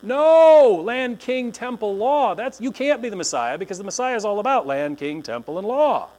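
high, loud indignant protest; mock indignant crowd shouting back; outraged crowd. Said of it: "People are like".

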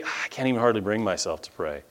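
soft, quick admission; lightly acted weary confession; listless student. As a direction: mumble it, mock dejected. Acts out student admitting neglect.

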